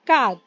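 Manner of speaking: "कात" punched out loud like French quatre. Shown as a demonstration. Teacher loudly modelling quatre for the class. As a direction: hit "कात" hard, the r dropped. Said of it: "का है, त है and ह है".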